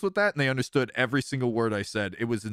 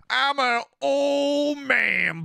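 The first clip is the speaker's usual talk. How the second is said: slow, high and loud mock shout; 11 semitones higher, 4 dB louder, much slower than usual. Real, not acted. Jokingly shouting his age.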